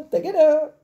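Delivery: high, loud silly shout; playful cry. Acted. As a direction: burst out like a fanfare. Playful exclamation, far voice.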